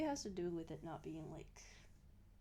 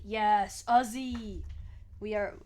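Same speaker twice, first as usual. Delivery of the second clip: "Aussie" loud with a falling end; big shouted-out "Aussie", landing firmly; loud confirmation; 10 dB louder, slower than usual. Real, not acted. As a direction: answer a loud, emphatic yes, Aussie.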